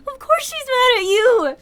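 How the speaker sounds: real angry outburst. Real, not acted. Player siding with Mary against Kroven, loud. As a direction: shout it at the screen for her. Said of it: "I'm mad for Mary, too".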